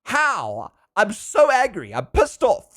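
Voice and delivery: imitating a high, protesting outburst; retold anger. Retelling an outburst at school friends, mock angry. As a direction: pitch climbs in mock fury. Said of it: "when I got angry".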